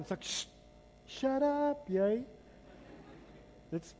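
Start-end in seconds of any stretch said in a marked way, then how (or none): 0.2-2.2 lightly done rough biker line; biker talk. Pretend. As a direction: toss it off like a biker telling someone to pipe down. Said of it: "That's biker language".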